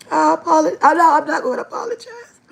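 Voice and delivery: real laugh breaking into crying; laughing through tears. Real, not acted. Telling viewers about the dance, laughing through tears. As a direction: laugh and cry at once. Apologizing while overcome with emotion.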